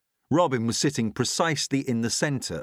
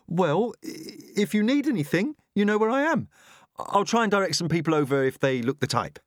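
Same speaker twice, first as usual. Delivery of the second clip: lightly voiced cheerful young woman, pitched higher; chirpy helper, trying to keep her smile up; bright young shop assistant; 8 semitones higher than usual. A narrator's reading. Wren, a bookshop employee, offers help to the author, cheerfully high.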